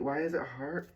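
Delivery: ordinary talk, nothing marked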